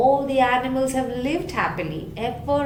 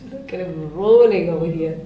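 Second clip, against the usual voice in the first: teasing, laughing louder as Hyena; mocking laugh; 5 semitones lower, 6 dB louder than usual. Hyena jeering at the honey-coated animals, laughing. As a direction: jeer at the sticky animals.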